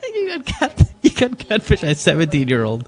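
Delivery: cracking up while teasing; gleeful ribbing through helpless laughter; real laughter. Real, not acted. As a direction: laugh so hard the first words barely get out. Ribbing co-host over catfishing.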